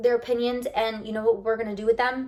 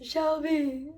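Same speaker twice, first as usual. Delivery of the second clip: mock crying; 5 semitones higher, 3 dB quieter than usual. Acting out a fan's crying text, mock sobbing. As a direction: fake sob on the name, like a crying emoji. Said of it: "I'm doing the emoji face".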